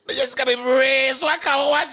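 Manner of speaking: high, loud laughing exclaim. Real, not acted. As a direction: laugh through the words.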